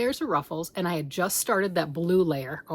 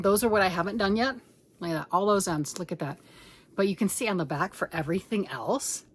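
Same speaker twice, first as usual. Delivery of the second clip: ordinary delivery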